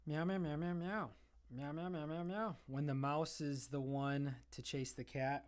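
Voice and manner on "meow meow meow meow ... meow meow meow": playful mock meows, a bit higher and louder; meowing at the cat. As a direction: meow back at the kitty to tease her.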